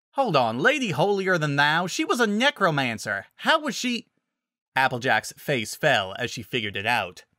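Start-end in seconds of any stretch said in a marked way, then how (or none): 0.1-4.1 higher, climbing incredulous question; object, pitch rising as you puzzle it out; confused protest, increasingly high; baffled objection. Acted. Paladin puzzled, objecting to the nickname.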